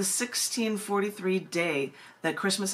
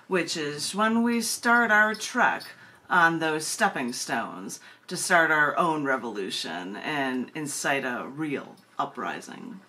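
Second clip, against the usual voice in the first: lower, measured poem-reading voice; poetry reading; 5 semitones lower than usual. Acted. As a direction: read it out like a poem on stage. Reading her own poem aloud.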